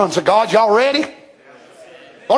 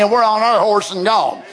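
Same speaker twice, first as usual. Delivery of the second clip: normal talking voice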